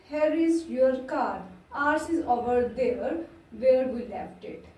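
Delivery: each English word read out slowly and clearly; slow dictation. Shown as a demonstration. Teacher reading the example sentence slowly to students, slow dictation. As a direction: dictate it slowly so the class can write. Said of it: "slow dictation of example".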